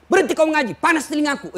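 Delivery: high, loud furious yell; angry outburst. Retold or imitated. Dying man yelling at the ustadz to stop reciting, high and loud.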